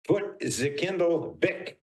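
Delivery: high, slow put-on character voice; movie line impression. Quoting Young Frankenstein to his guest, high and slow. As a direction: ham up the famous line. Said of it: "Young Frankenstein".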